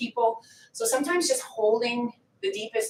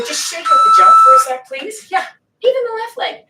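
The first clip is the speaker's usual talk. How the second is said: loud coaching; 8 semitones higher, 10 dB louder than usual. Real, not acted. Coach cues mid-set.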